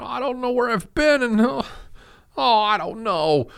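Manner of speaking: high, small, bewildered voice, slowing down at the end; a frightened lost man calling for help; lost and scared. Retold or imitated. Tom Hanks phoning his girlfriend, high and panicky. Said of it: "he doesn't know where he's at".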